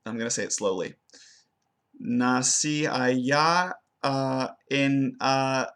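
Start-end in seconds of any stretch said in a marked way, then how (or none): 2.0-3.7 slow "Nací allá" with a dropping tune; mimicked intonation. Shown as a demonstration. Student mimics her faulty intonation, slow and halting. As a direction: recreate the stumbling melody slowly. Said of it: "I'm gonna try to mimic what I did before".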